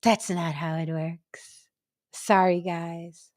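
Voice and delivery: low, slow and soft; mock-sorry verdict. Woman closing her lecture to the guys, mock-sorry. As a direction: let them down slowly, with finality.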